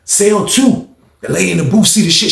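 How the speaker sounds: ordinary delivery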